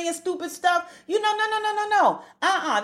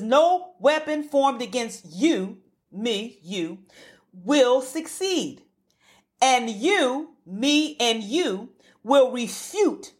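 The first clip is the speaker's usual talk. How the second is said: scripture reading; 4 semitones lower, much slower than usual. Acted. Reads scripture slowly.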